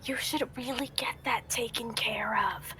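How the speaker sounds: very soft whisper; hushed, confidential whisper; whispered aside. Acted. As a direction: slip a discreet word of advice. Confiding aside to the mustached one.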